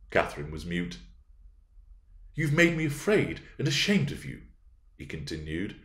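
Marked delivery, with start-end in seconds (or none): 2.3-4.4 put-on high, hurt young man; pained, high-pitched rebuke; wounded reproach. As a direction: reproach her, hurt and upset. Wounded suitor reproaches her in quarrel.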